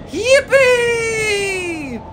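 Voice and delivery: soaring cry of pure joy; shouted yippee. A narrator's reading. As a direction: whoop it out like a kid on a ride. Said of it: "shouted Grandpa Joe again".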